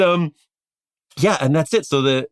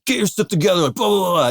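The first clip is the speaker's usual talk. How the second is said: gruff sergeant order; 8 semitones higher than usual. Retold or imitated. Grizzled sergeant barking an order.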